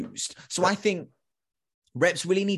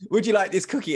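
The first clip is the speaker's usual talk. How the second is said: higher, perkier sales offer; cookie seller; 6 semitones higher, 4 dB louder, faster than usual. Acted. Mall worker offering a shopper a free taste, perky. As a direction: offer it brightly, pitched up. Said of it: "but anyway".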